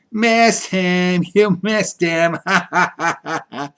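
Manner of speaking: laughing taunt in a singsong; a mean boy's laughing singsong; mocking singsong. A narrator's reading. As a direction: sing the jeer and laugh at her. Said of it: "sang that Jim I hate".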